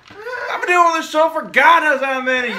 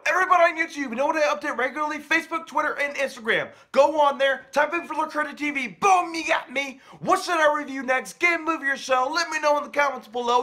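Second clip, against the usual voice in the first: softer quick sign-off read; outro plug; 2 semitones higher, 7 dB quieter, faster than usual. Acted. Host telling YouTube viewers where to follow him, as a soft quick sign-off. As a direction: rattle off the channel plug softly.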